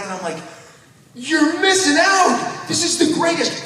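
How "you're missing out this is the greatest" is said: high, slow, mock-gushing shout. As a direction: yell it like a fan who can't contain himself. Urging friends to come back.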